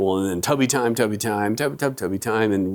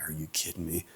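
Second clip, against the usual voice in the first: muttered disbelief; 7 semitones lower, 15 dB quieter than usual. Retold or imitated. Kids out again, dad hears.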